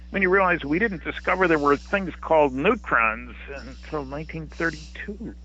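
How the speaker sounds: ordinary talk, nothing marked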